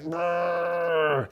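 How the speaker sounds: long high-pitched strained moan; mock contraction wail; imitated labour groan. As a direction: groan through a contraction, drawn out.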